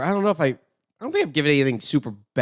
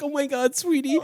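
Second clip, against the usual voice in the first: touched mom; 11 semitones higher than usual. Retold or imitated. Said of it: "She's like".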